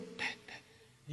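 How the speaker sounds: tiny, very faint imitated knock; barely audible tapping sound made with the mouth; timid knocking. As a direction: imitate a shy little knock. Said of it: "así muy, como muy tímido".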